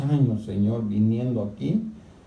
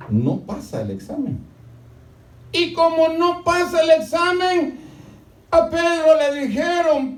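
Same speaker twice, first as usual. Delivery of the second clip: much higher, louder, slow pulpit cry; fervent high sermon shout, slow; full-force preaching; 6 dB louder, slower than usual. Acted. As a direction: drive God's words home at full voice.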